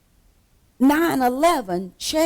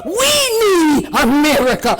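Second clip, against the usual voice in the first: impassioned preacher's cry; real pleading shout from the pulpit; hollering to heaven; 4 semitones higher, 7 dB louder than usual. Real, not acted. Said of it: "begin to holler to heaven".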